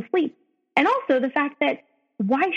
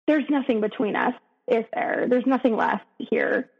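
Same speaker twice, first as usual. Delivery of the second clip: ordinary talk, nothing marked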